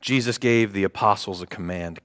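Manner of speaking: ordinary delivery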